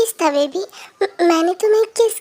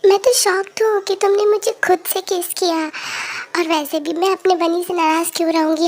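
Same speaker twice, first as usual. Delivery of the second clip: usual speaking voice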